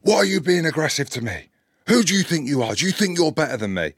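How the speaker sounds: high-pitched indignant rant, imitated; raging driver. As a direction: act out an affronted driver flying off the handle. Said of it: "for him to be that raging".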